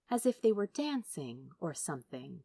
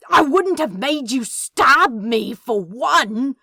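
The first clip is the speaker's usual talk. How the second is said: higher, much louder shout; 7 semitones higher, 13 dB louder, slower than usual. Acted. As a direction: yell it at him. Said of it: "shouted Crowley".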